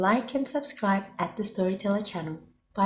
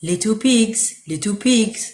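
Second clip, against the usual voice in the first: a polite big wolf, a bit louder; 3 semitones higher, 5 dB louder than usual. A narrator's reading. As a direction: ask nicely but loudly like a storybook wolf. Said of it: "Big Bad knocked politely on the door and said".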